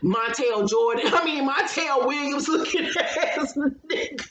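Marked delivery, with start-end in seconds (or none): 1.5-4.3 cracking up mid-insult; words dissolving into helpless laughter; laughing. Real, not acted. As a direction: break into real laughter as you speak.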